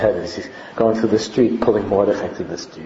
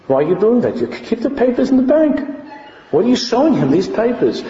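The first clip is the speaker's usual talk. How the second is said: high-pitched, acted exasperation; voicing alarmed onlookers; dismayed protest; 11 semitones higher than usual. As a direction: plead with him in rising disbelief. Acts out the people's dismayed protest.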